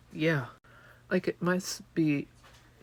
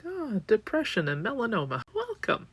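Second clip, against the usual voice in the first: higher mock-bright welcome; sarcastic welcome; 8 semitones higher than usual. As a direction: greet the room with cheery sarcasm.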